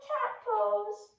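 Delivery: usual speaking voice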